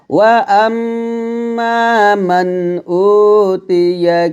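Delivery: slow, drawn-out Quranic recitation of the whole verse; full verse recitation. Shown as a demonstration. Teacher reading the whole verse for students, slow recitation. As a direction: chant it through slowly in one breath. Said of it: "তাহলে একসাথে যদি পড়ি".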